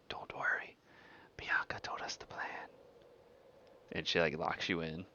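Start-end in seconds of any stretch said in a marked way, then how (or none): 0.1-2.7 near-whispered, conspiratorial aside; low-volume, breathy secret between allies; hushed reassurance. Acted. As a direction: quietly let them in on the secret.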